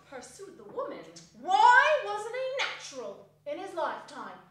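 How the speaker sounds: scornful charwoman. Acted. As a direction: rant at the wicked old screw. Charwoman rails at the dead miser.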